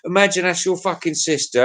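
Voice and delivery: ordinary talk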